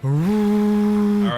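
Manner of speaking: big, high booming intro; announcer shout. Pretend. Announcing Antonio's arrival.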